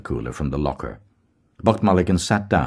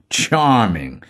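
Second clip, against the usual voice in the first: 1 finical sweet voice; 5 semitones higher than usual. A narrator's reading. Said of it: "in a finical, sweet voice".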